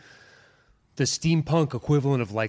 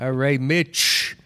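mock New York accent; much slower than usual. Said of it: "Now it's New York".

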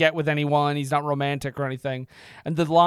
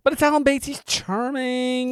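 mock swoon; 7 semitones higher than usual. Swooning over Alan Bates.

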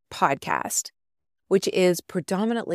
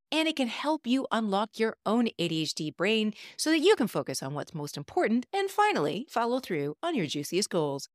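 upbeat promo read; 4 semitones higher, 4 dB quieter than usual. Acted. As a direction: pitch it like a radio spot. Recorded promo for her program.

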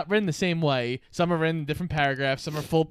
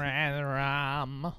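creepy Tony voice; 6 dB quieter than usual. Retold or imitated. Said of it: "creepy fucking Tony voice".

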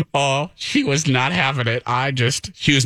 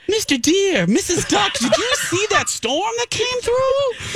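high, slow sing-song little animal voice; cute woodland critter voice. Retold or imitated. Coworker imagined as a badger greeting woodland friends, high cartoon voice. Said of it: "She's like little badger that comes out".

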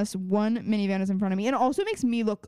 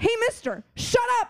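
a high mock-childish retort; mock scolding; 11 semitones higher than usual. Retelling her reply to a rude driver, high mock scolding. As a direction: sass him back in a much higher voice. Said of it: "I was like".

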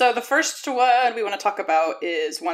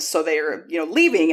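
ordinary talk, nothing marked

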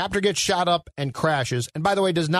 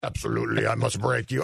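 low, mock Russian heavy; comic Russian tough-guy accent; 6 semitones lower than usual. Actor auditioning for the director, Russian accent. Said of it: "can you do a Russian accent".

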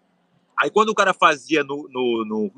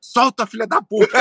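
higher-pitched mock yell; retold shout from afar; 5 semitones higher than usual. Retelling his sneaky heckle to a friend lifting the guru, mock distant shout. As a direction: imitate calling out from a distance, half hidden. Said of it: "eu gritei lá de longe".

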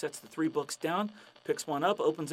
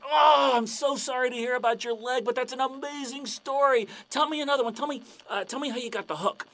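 higher, pleading and excited; eager fan; 9 semitones higher, 5 dB louder than usual. Acted. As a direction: beg for the story. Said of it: "the guy's like".

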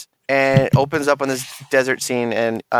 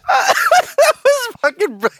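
laughing; 4 dB louder, much slower than usual. Real, not acted. Laughing after intro.

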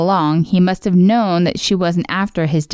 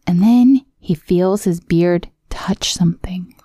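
usual speaking voice

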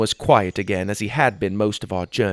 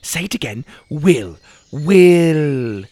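put-on coaxing a baby; 5 semitones higher, 7 dB louder, slower than usual. A narrator's reading. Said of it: "I pointed to my chest".

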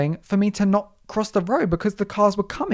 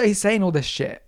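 ordinary delivery

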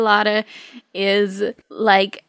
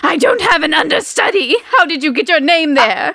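angry diva; 5 semitones higher, 4 dB louder than usual. Acted. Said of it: "screams in rage".

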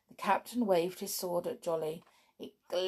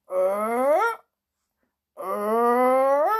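high, drawn-out winding creak; key winding sound; 4 semitones higher, 8 dB louder than usual. Acted. Key winding up the clown. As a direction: make the noise of a key turning. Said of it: "went the key".

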